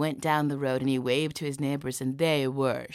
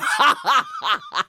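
high, loud staged cackling laugh; acted mocking laughter; 9 dB louder than usual. Soldier still laughing at Isaac, high and loud. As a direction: burst out laughing at him. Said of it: "he wouldn't laugh at him".

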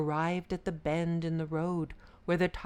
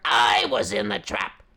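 angry tiger; 3 semitones higher, 10 dB louder than usual. Acted. Tiger insists.

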